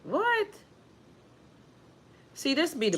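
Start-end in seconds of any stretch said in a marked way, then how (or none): 0.0-0.5 sudden high squeak of disbelief; a startled, rising what; shocked what. Real, not acted. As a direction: caught off guard by a rude message. Shocked by a live message.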